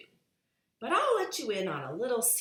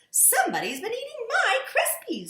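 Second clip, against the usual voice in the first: much higher, lightly voiced Mrs Blair; high mother voice; 7 dB louder than usual. A narrator's reading. Missus Blair complaining about her cereal, high-pitched. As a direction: raise the voice for the mum. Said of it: "said Missus Blair".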